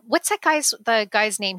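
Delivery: ordinary talk, nothing marked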